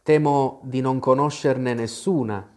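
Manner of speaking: usual speaking voice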